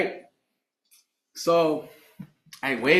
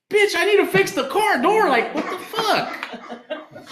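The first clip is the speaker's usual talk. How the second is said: high-pitched retold rant, trailing into a drawn-out curse; exasperated scolding; 12 semitones higher, slower than usual. Man scolding woman about broken car door, exasperated. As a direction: act out fed-up disbelief, pitch soaring. Acted quarrel over the beer run.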